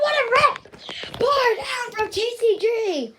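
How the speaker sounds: high, slow, stretched goal shout; excited goal call. Retold or imitated. Boy recalling his own goal call, high stretched cheer. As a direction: call it like a thrilled announcer. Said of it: "and then I was like".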